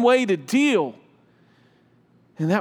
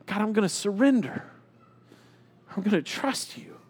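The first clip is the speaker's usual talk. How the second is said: very soft closing prayer; soft, prayerful yielding; hushed surrender; 7 dB quieter than usual. Acted. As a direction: let it fade into quiet trust.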